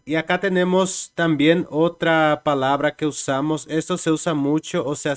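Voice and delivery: ordinary talk, nothing marked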